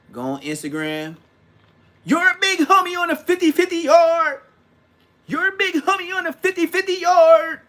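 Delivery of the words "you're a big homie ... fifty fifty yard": high, loud, held-pitch taunting call; mocking mimicry. Mimicking a rival's Instagram callout, high mocking yell. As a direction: shout it high on one note, mocking.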